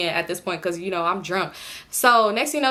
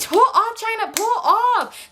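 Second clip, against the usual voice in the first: high, slow panicked plea to drive; dragged-out high panicky order; panicked urging; 12 semitones higher, much slower than usual. Retold or imitated. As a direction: beg her to pull off. Urging friend to drive away.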